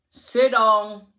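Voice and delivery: loud stern command; barked order. Retold or imitated. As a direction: bark it like an angry boss. Boss orders her to sit.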